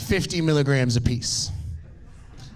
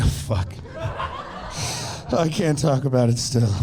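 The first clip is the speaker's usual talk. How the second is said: laughing; 3 semitones lower than usual. Real, not acted. Choked up laughing over movie.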